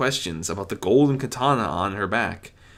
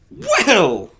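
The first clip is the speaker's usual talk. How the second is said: loud, high showman call; Discord voice; 9 dB louder than usual. A narrator's reading. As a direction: grab everyone's attention.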